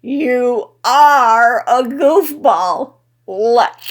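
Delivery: loud, slow tipsy teasing; drunken teasing. A narrator's reading. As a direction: tease him, slow and drunk.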